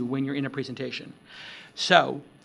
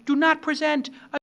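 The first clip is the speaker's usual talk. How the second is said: emphatic outburst; 11 semitones higher than usual. Real, not acted. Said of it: "beep that out".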